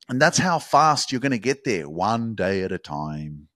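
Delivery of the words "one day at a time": slow emphatic mantra. Real, not acted. Repeated mantra, slow and soft.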